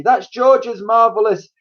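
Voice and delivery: high, loud jealous rant; mock the boy's name; shouting hag. A narrator's reading. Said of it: "shouted Grandma".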